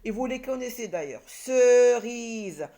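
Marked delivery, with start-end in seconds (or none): 1.3-2.7 CERISES loud and stretched, ceee-riiise; syllable by syllable. Shown as a demonstration. Teacher prepares to read syllables, CERISES loudly drawn out. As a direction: draw out both vowels of CERISES. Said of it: "On va lire les syllabes".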